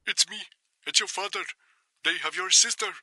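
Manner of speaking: high-pitched frightened father; scared, strained, quiet. A narrator's reading. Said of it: "strained, quiet, but also scared".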